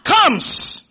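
loud, high call-and-response phrases; punchy preacher's prompt, word by word; congregational shout. Shown as a demonstration. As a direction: call it out for the crowd to echo. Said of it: "Come on, shout".

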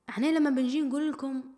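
normal talking voice

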